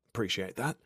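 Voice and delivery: softly muttered thanks. A narrator's reading. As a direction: mutter it under your breath. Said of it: "I mutter".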